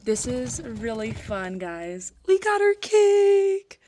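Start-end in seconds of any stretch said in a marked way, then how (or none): 2.3-3.8 high, loud, slow cheer; excited cheer. Real, not acted. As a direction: cheer it out triumphantly. Cheering the cake.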